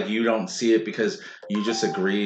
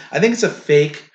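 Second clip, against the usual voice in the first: usual speaking voice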